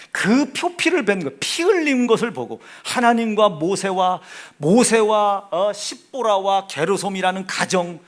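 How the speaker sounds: loud, driving sermon delivery; insistent pulpit proclamation; forceful preaching. Acted. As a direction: preach it with force, punching key words.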